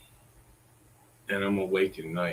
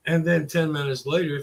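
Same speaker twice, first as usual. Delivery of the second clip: ordinary talk, nothing marked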